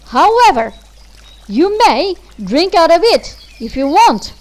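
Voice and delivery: loud, fast, sneering contempt; spoiled girl insulting the fairy; proud, rude. Acted. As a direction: spit it out with haughty scorn. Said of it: "said the proud, ill-bred girl".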